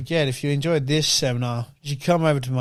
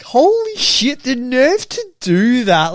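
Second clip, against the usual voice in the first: much higher, louder and slow, stunned; shocked disbelief; 8 semitones higher, 7 dB louder, much slower than usual. Retold or imitated. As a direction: gasp it out, floored by his nerve. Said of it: "it's like".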